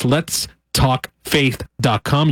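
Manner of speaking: very slow, a pause after each word; slow and spelled out. Shown as a demonstration. Radio host repeats the web address for listeners, slowly spaced out. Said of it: "if I said that too fast".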